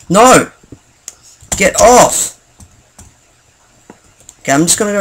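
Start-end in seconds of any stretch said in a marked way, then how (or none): 0.1-2.4 high, sharp cry of protest; alarmed protest. Real, not acted. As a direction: call out to fend off an attacker. Shouting at an attacking player.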